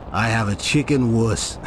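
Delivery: usual speaking voice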